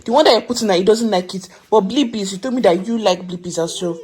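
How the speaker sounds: loud firm telling-off; scolding. Real, not acted. Mother scolds daughter over cartoon.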